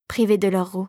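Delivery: plain reading voice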